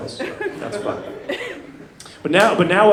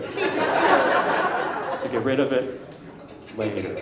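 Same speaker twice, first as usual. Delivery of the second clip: softer, slow, mocking repeat of "later"; mocking echo; 5 semitones lower, 8 dB quieter, much slower than usual. Shown as a demonstration. Echoing his girlfriend's excuse to the crowd, soft and mocking. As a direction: drag it out slowly, quietly mocking her excuse.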